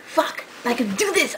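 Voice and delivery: normal talking voice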